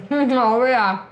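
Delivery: talking with mouth full. Real, not acted. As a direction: speak around a mouthful of food. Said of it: "I don't like to talk with my mouth full".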